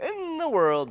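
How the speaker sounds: high, sing-song "In the world.". Shown as a demonstration. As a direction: lift "In the world." way up to hype the listener. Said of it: "Voice inflection, man. Get you excited".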